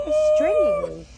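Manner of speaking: high, loud disgusted squeal; shocked squeal. Real, not acted. Struggling to bite the stick.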